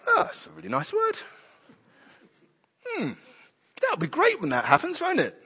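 dismissive, high sing-song mock musing; mock complacent. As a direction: lazily brush off the prophecy. Mocking complacent church leaders.

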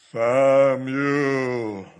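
loud, drawn-out divine call; booming voice of God. Retold or imitated. Preacher imitating God calling Samuel, booming. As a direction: call the name like a voice from heaven. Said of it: "the way God's voice must have come to Samuel".

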